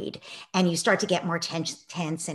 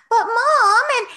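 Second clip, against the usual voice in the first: high, loud pleading whine; whiny kid; 11 dB louder than usual. Retold or imitated. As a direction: beg like a whiny child. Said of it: "very revved up, but also very whiny".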